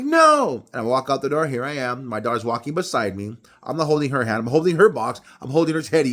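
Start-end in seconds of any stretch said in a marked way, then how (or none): 0.0-0.6 high, loud squeaky refusal; incredulous no. Retold or imitated. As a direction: refuse in disbelief. Dad refusing daughter.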